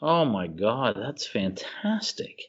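usual speaking voice

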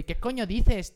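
quoting friends from Spain; Castilian accent. Retold or imitated. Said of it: "cada vez que llego y". ("dices" pronunciado con zeta española)

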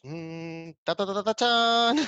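hummed build-up into high, loud "tachán"; magician's fanfare. Retold or imitated. Recalling playing magicians with cousins, big fanfare. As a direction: drumroll "ta, ta, ta" bursting into sung "tachán". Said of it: "jugando a los magos".

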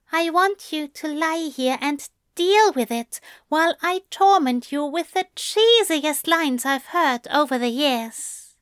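high-pitched, playful angel character; high voice, relishing the torment; teasing angel. A narrator's reading.

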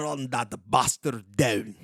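slow put-on Scottish accent. Host showing off his accents, slow Scottish accent. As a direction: growl an order in mock Scottish. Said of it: "I actually do a pretty good Scottish one".

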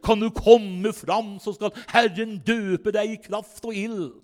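slow, chanted altar call; imitated intoning preacher calling people forward. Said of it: "han hadde jo sånn litt sånn messende stemme".

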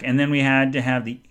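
ordinary delivery

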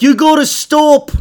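high, loud, drawn-out mock English doctor; bad English accent. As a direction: put on a hammed-up English accent, slowly. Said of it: "He was an English doctor".

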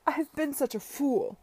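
high, loud lament; wailing. A narrator's reading. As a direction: wail in drunken self-reproach. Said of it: "he wailed".

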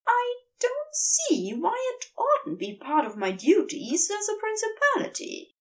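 high, haughty angel voice. A narrator's reading. The angel defends his duties to the demon, high and haughty. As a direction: draw yourself up and sniff, high and prim. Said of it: "drew himself up haughtily".

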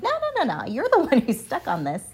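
high-pitched, half-laughing retort; mock protest. Student talking back to her stuck advisor, high mock protest.

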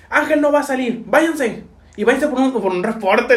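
high-pitched, brisk dismissal; imitated scolding. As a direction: send them off like a scolding adult. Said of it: "les pegó una pinche cagotiza".

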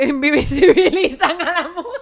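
high, loud words through laughter; laughing while reading. Real, not acted. Host reading a listener's message, laughing hard. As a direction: read on while cracking up.

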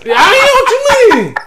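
high, loud words through laughter; cracking up while arguing; laughing. Real, not acted. Two friends laughing at each other mid-argument, high and loud.

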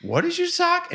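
very high, squeaky disbelief; stunned disbelief. Retold or imitated. Dad retelling his daughter's sass, squeaked in disbelief. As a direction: pitch it way up in shock.

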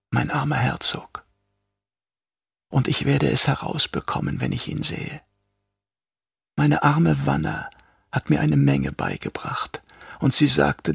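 soft, quietly fanatic Yui; lightly voiced traitor doctor. A narrator's reading. Yui explains his bargain.

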